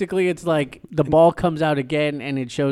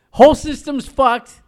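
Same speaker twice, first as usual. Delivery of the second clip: higher and louder mock exclamation; stunned realization; 8 semitones higher, 6 dB louder than usual. A viewer reacting to the movie's ending, stunned and loud. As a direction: blurt it out as if stunned.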